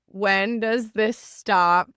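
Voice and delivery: retold sob-laden plea to a chatbot; tearful plea. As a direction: ask it through tears, drawn out.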